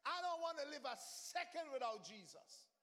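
ordinary talk, nothing marked

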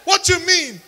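high shouted comeback; defiant retort. Pretend. Believer answering a critic, shouted defiant retort. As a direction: throw it back at the critic, loud.

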